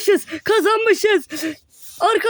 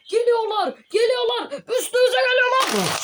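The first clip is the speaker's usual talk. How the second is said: mock panic; 9 semitones higher than usual. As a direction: voice climbing much higher as the alarm builds. Enemies charging at them. (yükselen sahte panik)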